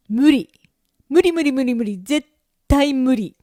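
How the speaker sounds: comic flat-out refusal, punched hard; emphatic refusal. Acted. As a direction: shut the idea down with rising force.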